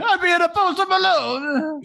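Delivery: high, hammed-up fake Jamaican accent; an over-the-top bad Jamaican accent; mock Jamaican accent. Host riffs on the scammer in a mock high Jamaican accent. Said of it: "Yeah, yeah".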